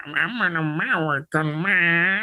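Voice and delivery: a lazy put-on American accent, drawn out slowly; mock an American accent the way they mocked his. Yorkshireman teasing a friend back, mock American accent. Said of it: "that was just like me going".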